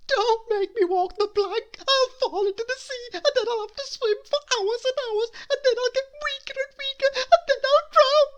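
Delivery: high, loud, fast panicky babble building to a wail; panicked pleading. Acted. Cabin boy begging not to walk the plank.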